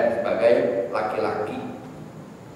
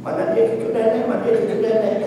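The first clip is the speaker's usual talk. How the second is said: excited doting grandmother; 10 semitones higher than usual. Acted. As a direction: coo eagerly for the grandchild. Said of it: "mertua udah loncat-loncat". (nenek girang menyambut cucu)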